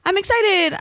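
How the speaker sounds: high bursting excitement; excited. Retold or imitated. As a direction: gush it out high and loud.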